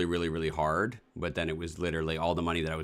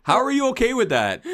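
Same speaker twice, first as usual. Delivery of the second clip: much higher, louder, squealing disbelief; shrill outraged shriek; 10 dB louder than usual. Real, not acted. Repeats outraged question.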